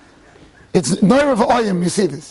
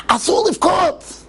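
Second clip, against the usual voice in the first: higher, pleading consolation to a crying friend; loving comfort; 9 semitones higher, much slower than usual. Retold or imitated. Old rabbi begs his crying friend, high pleading comfort. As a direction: soothe him like a weeping child, voice raised. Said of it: "So Scheinberg said".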